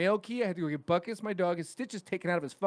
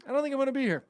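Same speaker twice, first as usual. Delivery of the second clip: high mock reply; 9 semitones higher, much faster than usual. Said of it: "I look and I go".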